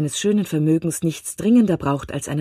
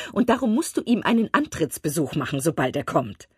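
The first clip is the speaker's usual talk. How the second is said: high, gushing chatter of an eager mother; excitable Mrs Bennet; 6 semitones higher than usual. A narrator's reading. Mother gossiping to husband about rich bachelor, gushing and high. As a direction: spill the gossip breathlessly pitched up.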